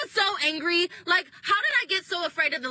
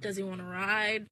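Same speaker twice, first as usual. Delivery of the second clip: much softer, lower, suggestive question; 7 semitones lower, 8 dB quieter than usual. Shown as a demonstration. Repeating the question to hint at sex, low and soft. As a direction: ask it with a sly innuendo. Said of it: "If you know what I mean".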